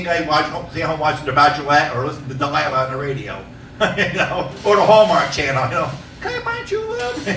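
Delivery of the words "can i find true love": high, thin, pitiful voice; mock lonesome guy. Comic mocking lonely guys at home, high and pitiful. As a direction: whine it like a lovesick loser.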